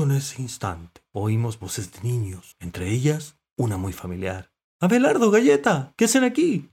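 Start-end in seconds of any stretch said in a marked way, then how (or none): 4.8-6.7 high, loud surprised call; shout from afar. A narrator's reading. Girl spotting her pets from afar, surprised shout. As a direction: call out across a distance. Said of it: "gritó desde lejos Marina".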